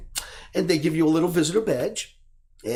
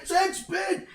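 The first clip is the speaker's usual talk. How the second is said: high shouted bid; screaming bid. Retold or imitated. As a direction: scream the bid over your shoulder. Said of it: "he's screaming".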